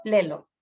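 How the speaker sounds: lelo, short and falling; child's simplified word. Shown as a demonstration. Therapist shows lelo in place of luego. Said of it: "en vez de luego".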